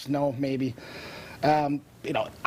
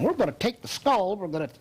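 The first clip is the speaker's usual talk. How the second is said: mock southern-accented neurosurgeon, pitched up at the start; 3 semitones higher than usual. Surgeon to patient in a hospital bed, mock southern accent. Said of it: "with that southern accent saying".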